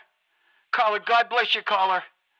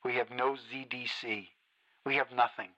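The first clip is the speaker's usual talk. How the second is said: much lower and softer, bitter and drained; quiet seething despair; 9 semitones lower, 6 dB quieter than usual. Acted. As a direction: let the anger collapse into a low murmur. Host sinks into bitter despair.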